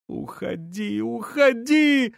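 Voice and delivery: very high, stretched plea to go away; woman crying out. A narrator's reading. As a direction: start hushed and high, climb to a shriek. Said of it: "Женщина кричала".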